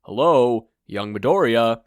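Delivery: loud call to break in; calling for attention. Acted. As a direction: raise your voice to get his attention. Toshinori calls louder to interrupt.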